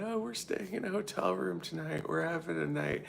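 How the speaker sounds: high, mock bubbly voice; mocking them. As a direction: sing it out like excited party girls. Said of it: "they were like talking about".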